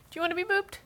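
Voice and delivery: high squeaky boops. Real, not acted. Booping cat, baby talk.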